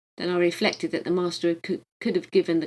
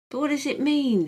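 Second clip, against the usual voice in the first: higher, puzzled question; bewildered; 8 semitones higher, 4 dB louder than usual. A narrator's reading. Father confused by his son's casual greeting, bewildered. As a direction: ask it lost and confused. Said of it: "he asked in bewilderment".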